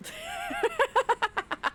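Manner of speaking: a high, airy giggle at herself. Real, not acted. Laughing at sliding around.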